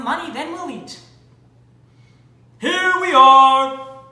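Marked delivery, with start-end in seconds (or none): 2.6-4.0 slow, loud homecoming call; loud announcement. Acted. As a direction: call it out grandly. Father arrives home.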